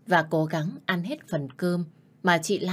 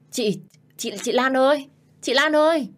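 higher and louder, calling out in fright; stammering frightened call; 8 semitones higher, 5 dB louder than usual. Acted. Young wife calls the maid after the incense flares, frightened shout. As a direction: stutter then shout for help. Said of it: "Tôi lắp bắp gọi chị Lan".

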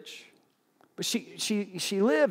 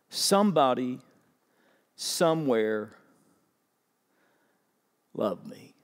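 each word slowly, dropping away; slow, fading; slower than usual. Acted. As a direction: let it die out word by word. Slow quoted diary line, fading.

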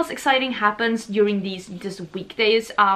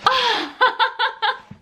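high, giddy laugh; giddy excited laughter; delighted laugh; 13 semitones higher, 3 dB louder than usual. Real, not acted. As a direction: laugh with joy. Delighted at many items.

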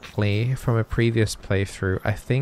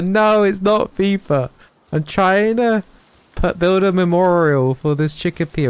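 exasperated retort; 7 semitones higher, 5 dB louder than usual. Real, not acted. Player asked if it's fifa, exasperated and loud. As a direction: brush off the silly question.

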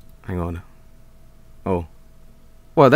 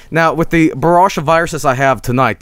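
ordinary delivery

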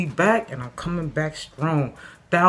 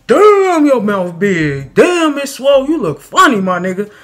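high, loud shocked yell; shocked loud teasing; 9 semitones higher, 11 dB louder than usual. Retold or imitated. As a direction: shout it out, amazed and mocking. Friends reacting to his swollen mouth.

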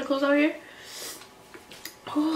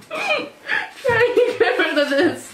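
laughing through tears; 6 semitones higher, much slower than usual. Real, not acted. Burning mouth, laughing and crying.

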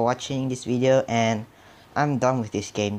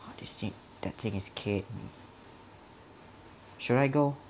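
ordinary talk

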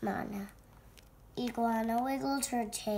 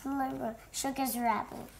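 plain reading voice

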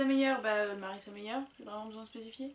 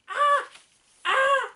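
very high, loud excited cries; delighted squeal; 13 semitones higher, 11 dB louder than usual. Real, not acted. Reader thrilled to find the favourite books page, squealing.

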